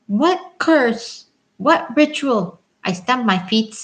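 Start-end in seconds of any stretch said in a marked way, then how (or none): 0.1-2.6 sharp, high and puzzled demand; confused anger. Acted. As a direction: fire the questions at them in confusion. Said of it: "feeling more and more confused, angry".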